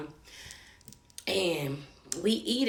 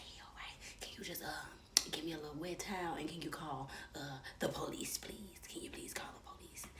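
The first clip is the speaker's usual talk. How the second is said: urgent hushed whisper; 5 semitones lower, 18 dB quieter than usual. Retold or imitated. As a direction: keep it secret from the room, whisper fast. Whispering to manager, avoiding scene.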